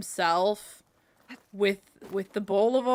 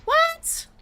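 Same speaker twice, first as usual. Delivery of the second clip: sudden high-pitched yelp of surprise; startled what; 7 dB louder than usual. Real, not acted. Player startled mid-game, high surprised yelp. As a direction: jump at a sudden shock.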